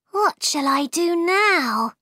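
put-on high, dismayed girlish question; Alice to herself. A narrator's reading. Alice is left with two sleeping queens, anxiously wondering. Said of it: "said Alice to herself".

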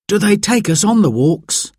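put-on high-pitched boy's question; child's voice. Simon asking Dad about the guides, high child's voice. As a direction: ask eagerly in a high child's voice.

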